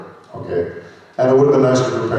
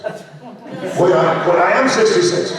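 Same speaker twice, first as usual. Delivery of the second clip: real laughter, voice pitched up through the joke; laughing at himself; 9 semitones higher, slower than usual. Real, not acted. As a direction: laugh as it dawns on you. Joking about own age.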